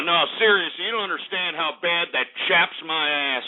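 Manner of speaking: ordinary talk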